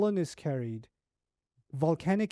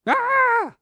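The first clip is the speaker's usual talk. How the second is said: short, very high loud scream; 9 dB louder than usual. Retold or imitated. Preacher acting out the wife's scream, screaming. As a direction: let out a sudden piercing scream. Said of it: "His wife started screaming".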